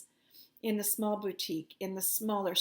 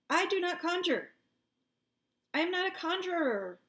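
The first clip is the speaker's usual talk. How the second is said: indignant denial; 9 semitones higher than usual. Real, not acted. Denying she conjures, indignant.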